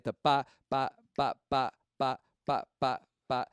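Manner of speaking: rhythmic pa syllables tapping out the clave; vocal clave pattern. Shown as a demonstration. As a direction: sound out the clave beat with crisp pa, pa. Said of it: "I played a, a clave pattern for you guys".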